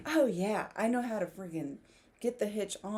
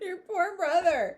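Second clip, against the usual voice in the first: speaking through laughter; high, loud, giggling words; laughing; 9 semitones higher, 8 dB louder, slower than usual. Real, not acted. Laughing while reading.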